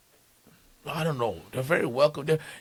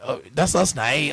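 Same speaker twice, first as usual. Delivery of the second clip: friendly, melodic Northern Irish lilt, pitched up; Northern Irish accent; 5 semitones higher, 5 dB louder than usual. Shown as a demonstration. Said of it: "the Northern accent is very, like, more like, friendly because it sounds melodical".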